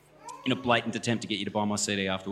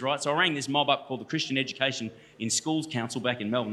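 normal talking voice

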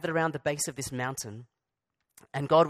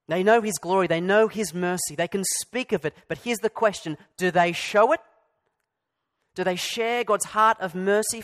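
louder, higher impassioned preaching; preaching at full force; 4 semitones higher, 5 dB louder than usual. Real, not acted. Preacher rebuking Israel, impassioned and louder. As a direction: drive the rebuke home.

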